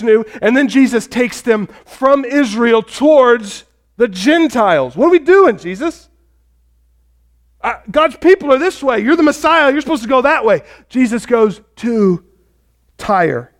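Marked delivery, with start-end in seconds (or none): 5.0-10.6 disciples protesting. Acted.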